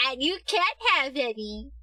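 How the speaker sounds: high, loud playful accusation; playful accusing. Real, not acted. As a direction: call them out teasingly.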